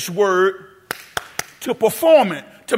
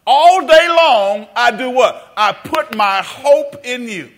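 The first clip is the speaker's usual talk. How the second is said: slow emphatic reading; 2 semitones higher, 5 dB louder, slower than usual. Shown as a demonstration. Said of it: "Read that one more time, out loud".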